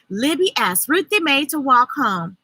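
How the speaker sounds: ordinary delivery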